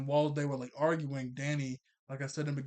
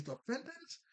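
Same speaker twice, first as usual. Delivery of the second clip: scoffing; 10 semitones higher, 7 dB quieter than usual. Retold or imitated. Valerie scoffs at the Fentons.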